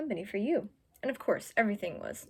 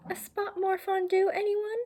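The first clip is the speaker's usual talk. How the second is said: much higher, bright little mouse offering; squeaky mouse; 10 semitones higher than usual. Acted. Mouse breaking the tense silence at dinner, in a squeaky high voice. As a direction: chirp it up high like a tiny mouse.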